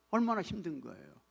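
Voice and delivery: usual speaking voice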